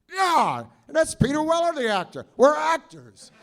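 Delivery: loud, high proud announcement; loud eccentric actor boasting; Lloyd impression. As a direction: declare it like a manic star. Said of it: "He says".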